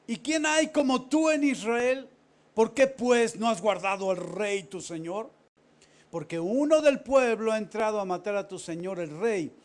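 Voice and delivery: slow, high and loud, calling across the hills; loud mocking challenge from afar; shouted taunt. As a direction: bellow the reproach at Abner. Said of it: "Y dijo David a Abner".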